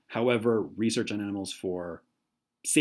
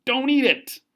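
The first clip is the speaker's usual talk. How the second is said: urgent warning; 13 semitones higher, 6 dB louder than usual. Acted. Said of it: "trying to persuade you".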